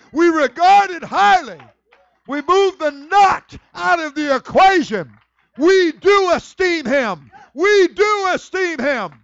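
high, shouted, drawn-out preaching; full-force preaching. Real, not acted. Preacher proclaiming the power of the highest to church, full-force preaching. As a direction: drive each declaration home at the top of your voice.

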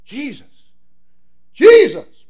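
a rising desperate call that bursts into a full shout; crying out. Acted. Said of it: "Began to cry out".

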